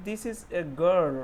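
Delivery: slow model reading. Shown as a demonstration. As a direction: read "This is a girl" slowly. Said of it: "दूसरा वाला sentence".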